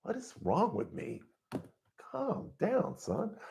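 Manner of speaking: quiet self-talk; soft self-scolding. Retold or imitated. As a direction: hush yourself like a worried parent. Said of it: "I'm just like".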